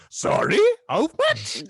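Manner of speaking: imitating a stunned friend at the bar price; shocked "how much". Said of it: "And then you went".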